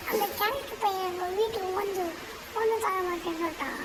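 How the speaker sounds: normal talking voice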